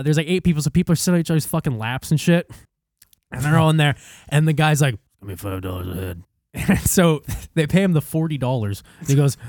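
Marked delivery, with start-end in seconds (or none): 5.2-6.2 put-on low, soft ninety-year-old voice; old man. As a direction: play a feeble old fellow offering help. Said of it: "ninety fucking year old man".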